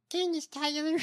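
high, altered mimicking voice; mocked candidate voice. Co-host trying the name in the mocked voice, high imitation. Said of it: "With that voice?".

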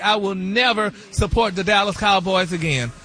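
ordinary talk, nothing marked

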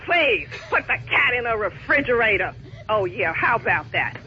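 low, dry sarcasm, the pitch dropped right down; mock the idea with low, contempt-filled repetition; sarcastic scorn. Real, not acted. Pet owner scornfully repeating the airline rep's idea, low and sarcastic. Customer mocks the rep's suggestion.

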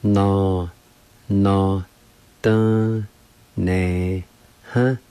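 "No, no, dun, ne, huh" drawn out, a clear pause after each syllable; teacher sounding out "No, no, dun, ne, huh" in separate syllables; syllable-by-syllable demo. Shown as a demonstration. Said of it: "is pronounced like this".

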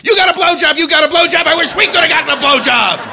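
high mock cheering shout; crowd screaming. UN delegates screaming their cheers. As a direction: chant it like a crowd at the UN. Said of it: "They were screaming".

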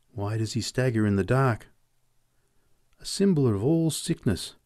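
ordinary delivery

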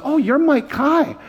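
put-on high slow child voice; lightly voiced small boy; little boy. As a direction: sound like an awed six-year-old. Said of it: "he goes".

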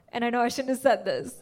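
ordinary delivery

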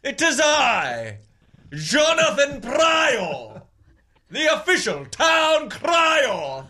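drawn-out, high British crier's cry; British town crier. Pretend. Host trying out as town crier, fancy British accent. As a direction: proclaim it slowly in a put-on British accent. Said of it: "Why are you sounding British?".

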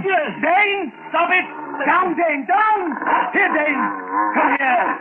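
high, urgent commands to the dog; yelling at the dog. Acted. [name] yelling to call the dog off his stepfather, urgent. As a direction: call the dog off sharply, pitch climbing on down. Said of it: "but I yelled".

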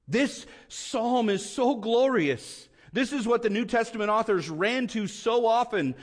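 high, insistent pulpit voice; impassioned preaching. Real, not acted. Preaches with raised intensity.

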